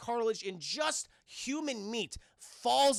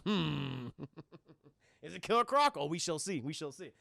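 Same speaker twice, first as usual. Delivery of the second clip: mysterious Batman musing; 3 semitones lower than usual. Retold or imitated.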